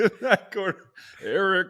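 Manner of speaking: high, loud hammed-up fight call; ring announcer. Host announcing the guest as a challenger, loud mock announcer.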